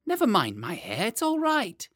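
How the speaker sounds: put-on high, squirming child protest; a wriggling boy pushing the nurse away; whiny small boy.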